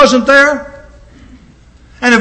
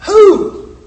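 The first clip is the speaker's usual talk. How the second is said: high, baffled "who?"; confused listener demanding who is meant; puzzled listener; 7 semitones higher, 4 dB louder than usual. Pretend. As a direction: ask it like you've lost the thread completely. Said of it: "you'd go".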